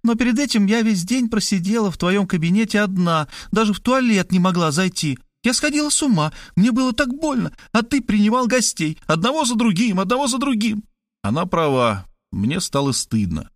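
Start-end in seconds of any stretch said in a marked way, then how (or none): 0.0-10.8 a higher, lighter woman's voice; Kristina's voice. Acted. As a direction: play the sister, pitched up and urgent. Kristina complains hurt.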